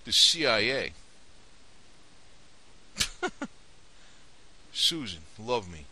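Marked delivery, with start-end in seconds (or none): 1.0-4.7 a long breathy laugh with almost no voice; long near-silent laugh. Real, not acted. Chuckling to the viewers at his own jab about the CIA, near-silent. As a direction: laugh it off without voicing it.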